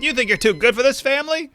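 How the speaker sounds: mocking the family's Boston accent in a high voice. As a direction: squeak it out like a scolding Boston mother. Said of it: "they're like".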